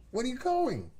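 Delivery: very high cooed question. Real, not acted. Owner asking the departing dog, very high baby talk.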